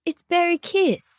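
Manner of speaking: cooing shade name. Pretend. Reading lipstick shade name.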